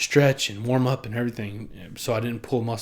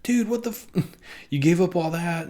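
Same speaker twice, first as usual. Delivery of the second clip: much higher incredulous friend; mock disbelief; 7 semitones higher than usual. Friends reacting to him quitting his business, high and incredulous. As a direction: squeak it out in shock.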